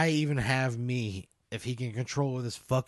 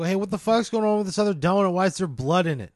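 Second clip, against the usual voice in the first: loud, fast, indignant questioning; rattled, rapid-fire confrontation; alarmed demand; 4 semitones higher, 7 dB louder than usual. Acted. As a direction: demand an explanation, louder and higher. Player confronts the back-seat passenger.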